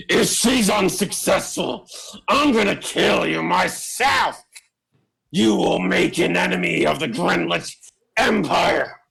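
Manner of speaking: high, strained villain voice; Grenlich. Acted. Grenlich threatening the players after their refusal, high strained voice. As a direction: threaten in a high, agitated character voice. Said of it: "Schmidt, Grenlich says".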